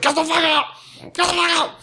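high mock yell to get out. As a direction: shout them out of the bathroom. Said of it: "you banged on the door".

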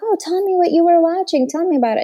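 put-on high, eager questions to a child; light up with interest in the kid's video; interested parent. Shown as a demonstration.

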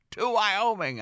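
gleeful toast at takeoff; exclaimed. Retold or imitated. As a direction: cheer it as the plane lifts off. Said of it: "I exclaimed".

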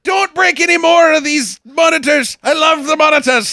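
high mock Scottish wail; hammed-up, distraught Scottish shouting; yelling Scotsman. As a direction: plead at a yell in a Scottish accent. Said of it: "having a yelling Scotsman on the boat".

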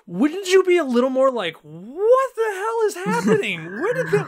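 high, alarmed, disbelieving; incredulous panic. Retold or imitated. Friends mocking the movie, high alarmed disbelief. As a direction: freak out at the helicopter. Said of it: "we're like".

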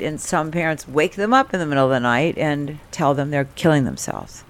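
normal talking voice

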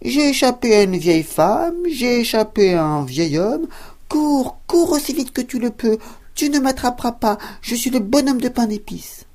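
high, loud sing-song taunt; cheeky cookie shouting back over his shoulder; gingerbread man taunting. Acted. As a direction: tease them from a safe distance, high and bright. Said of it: "cria par-dessus son épaule".

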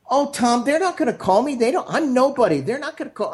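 high-pitched imitation of a discouraged student; self-doubting protest. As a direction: protest as if you're a nobody.

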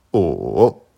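O dipping low then climbing back up; third tone on O. Shown as a demonstration. Teacher showing learners Mandarin O, dipping tone. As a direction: draw O down and up again. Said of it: "Troisième ton".

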